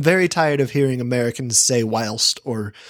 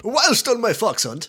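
higher, slow mock British gentry accent; jaunty British man; 8 semitones higher than usual. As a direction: play along as a hammed-up British hunter. Joins in the British gent act.

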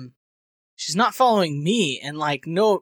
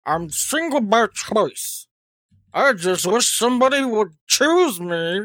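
manatee voice; 5 semitones higher, slower than usual. Pretend. Same manatee voice, reading the next joke.

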